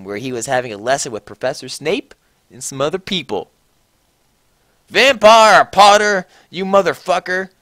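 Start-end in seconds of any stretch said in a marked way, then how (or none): 4.9-7.5 furious yell. A narrator's reading. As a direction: call him out across the classroom. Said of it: "I yelled".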